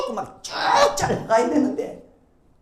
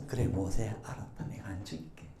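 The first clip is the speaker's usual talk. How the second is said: quiet voice of God; 13 semitones lower, 12 dB quieter than usual. Acted. God answering Moses softly.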